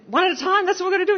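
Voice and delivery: bubbling with fresh determination; excited resolve. Retold or imitated. Telling Anne-Marie after the speech, high excited resolve. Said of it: "I turned to Anne-Marie and I said".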